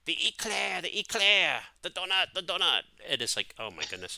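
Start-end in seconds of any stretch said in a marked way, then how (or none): 0.1-2.8 high-pitched catchphrase impression; Hervé Villechaize. Host imitating his humiliating Dunkin' Donuts commercials, high-pitched impression. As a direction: mimic the sidekick's call, pitched way up. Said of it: "he would go".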